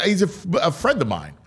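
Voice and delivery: normal talking voice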